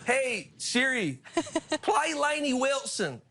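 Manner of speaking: slow, high imitation of a Southern drawl; mock Southern accent. Shown as a demonstration. Host copying Lainey's accent for Siri, slow mock Southern drawl. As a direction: stretch it out like a Louisiana local. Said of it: "Do it in your Lainey accent".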